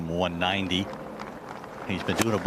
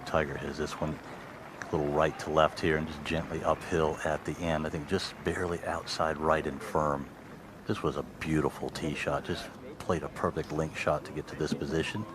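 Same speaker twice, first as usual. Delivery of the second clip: golf commentator; 3 semitones lower, 5 dB quieter than usual. Real, not acted. Hushed putt commentary.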